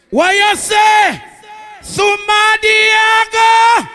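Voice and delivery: loud, high MC call over the music; hype shout. Real, not acted. MC calling out to the dance hall crowd, loud and high. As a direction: whip up the crowd.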